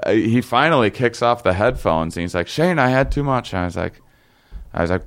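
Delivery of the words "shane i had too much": high-pitched mock alarm; retold freak-out. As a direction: imitate a panicking friend pleading for help. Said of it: "freaking out for like".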